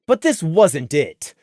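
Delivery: ordinary delivery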